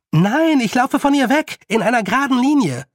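very high, loud retort; defiant call back. Acted. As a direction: shout back insistently while running. Girl calls back while running. (trotzig zurückrufen)

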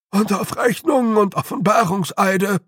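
high, loud character voice; high, fretful clansman joining the list; alarmed little man. A narrator's reading. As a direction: pile on with rising dread. Fourth clansman chimes in alarmed.

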